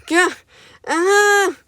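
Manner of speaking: high sharp cries of pain; hammed-up pain yelps; pained screams. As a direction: scream as pain shoots through the arm. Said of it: "I stop screaming in pain".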